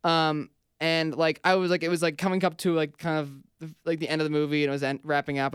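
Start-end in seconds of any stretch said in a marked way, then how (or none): none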